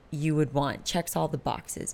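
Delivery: ordinary delivery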